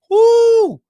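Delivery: high, loud whoop; loud woo. Real, not acted. Streamer whooping after a viewer's rap. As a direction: whoop in delight.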